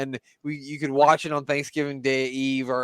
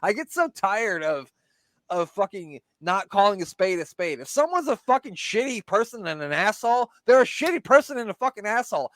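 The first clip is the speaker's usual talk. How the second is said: higher pitched, swearing outburst; angry rant; 8 semitones higher than usual. Real, not acted. Ranting at friends about the documentary, angry. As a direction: vent with rising heat. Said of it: "Get down off my soapbox".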